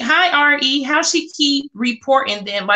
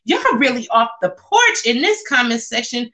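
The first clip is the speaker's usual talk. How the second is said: ordinary delivery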